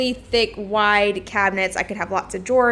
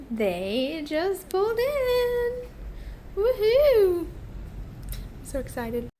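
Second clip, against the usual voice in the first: excited; 10 semitones higher, 3 dB quieter, much slower than usual. Real, not acted. Fridge delivery arriving, excited.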